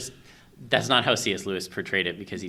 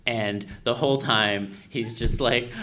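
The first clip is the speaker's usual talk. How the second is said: normal talking voice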